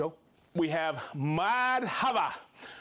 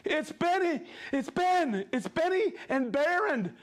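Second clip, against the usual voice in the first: excited; 9 semitones higher than usual. Real, not acted. Delighted to spot regular viewers.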